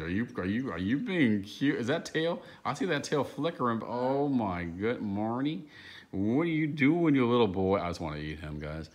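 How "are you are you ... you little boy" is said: high cooing voice for a dog, higher. Real, not acted. Trainer coos at the dog.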